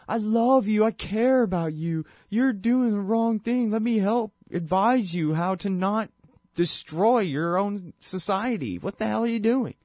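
a hint of a kind elder scolding gently; imitating Jimmy Carter. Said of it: "He's saying".

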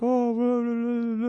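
a gentle, mildly imitated old statesman rambling on; imitating Jimmy Carter. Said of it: "listen to the way he talks".

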